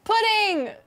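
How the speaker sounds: high, punched exclamation; gleeful mock shout. Dean in the asylum wanting dessert, high mock shout. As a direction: call it out high.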